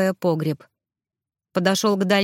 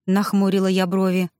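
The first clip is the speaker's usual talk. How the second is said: plain reading voice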